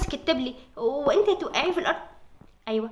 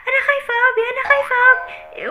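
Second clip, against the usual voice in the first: put-on scared child; 9 semitones higher, 7 dB louder than usual. Kids see smoke from tablet.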